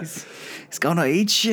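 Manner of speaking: playful mock warning in a Celtic accent; announcer's Irish-or-Scottish accent. Cohost finishing the shark warning, mock Irish-or-Scottish accent. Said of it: "like, Irish or Scottish".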